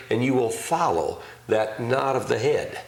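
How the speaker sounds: ordinary talk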